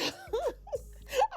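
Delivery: high-pitched giggle; high, helpless laugh; amused laugh. Real, not acted. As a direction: burst out giggling at your own impression. Amused by her own accent imitation.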